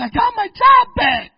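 high, loud, jubilant cry; joyful shout. Retold or imitated. Sister telling the pastor her job news, shouting for joy. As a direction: shout the news like a miracle happened.

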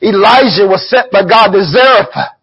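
ordinary talk, nothing marked

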